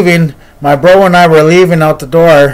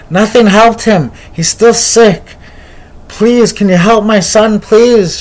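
higher, pleading voice of a worried mother; begging mother; 5 semitones higher than usual. Retold or imitated. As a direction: plead for help, pitched up. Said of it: "She was begging him".

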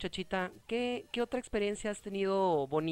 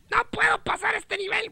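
very high, louder squeal of despair; hammed-up high-pitched frustration; mock frustrated outburst; 5 dB louder than usual. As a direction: whine it high in comic exasperation. Playful game frustration outburst.

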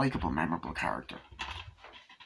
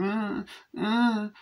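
imitated baby cries; 12 semitones higher than usual. Babies heard crying in scene.

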